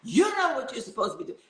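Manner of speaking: high, mock furious rant with laughter, fast at the end; imitating doctor's yelling. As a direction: imitate the doctor tearing into the residents, laughing through it. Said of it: "and yelling at those residents".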